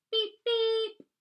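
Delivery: high, loud double horn beep; beep sound. Shown as a demonstration. Showing children how to make a beep sound, imitated horn. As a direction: toot it twice like a truck horn, high and loud. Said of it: "Can you make a beep sound?".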